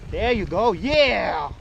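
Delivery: loud, rising cheer for a good run; excited cheering. Real, not acted. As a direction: shout praise as he nails it. Cheering kid down the trail.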